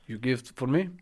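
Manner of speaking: lightly put-on foreign-accented bag seller; Jose Mourinho. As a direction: haggle in a mock Portuguese accent. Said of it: "I'm doing Jose Mourinho".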